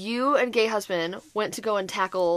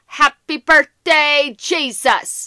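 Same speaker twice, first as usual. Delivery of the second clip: put-on drunk groaning; 4 semitones higher, 7 dB louder, much slower than usual. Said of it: "all of a sudden I hear this".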